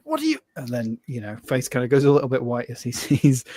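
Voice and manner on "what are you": high, startled aristocrat; posh outrage. Acted. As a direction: yelp it like an affronted lord. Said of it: "and it's like".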